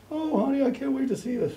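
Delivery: high, tender coo; imitated gushing mother. Mother longs to see approaching son, imitated gushing.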